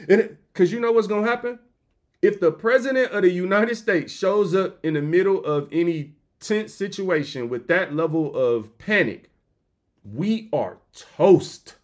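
ordinary delivery

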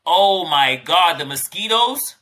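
normal talking voice

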